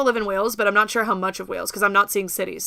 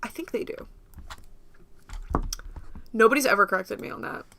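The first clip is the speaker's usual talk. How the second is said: usual speaking voice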